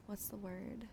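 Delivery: usual speaking voice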